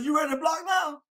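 high, loud demanding question; coach yelling. Retold or imitated. Coach yelling at the crying boy whether he'll block now. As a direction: challenge the crying kid, loud. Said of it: "he said".

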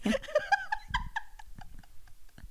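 shrieking laughter, high; high squealing laugh. Real, not acted. Huge laugh at absurd idea.

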